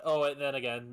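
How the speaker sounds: normal talking voice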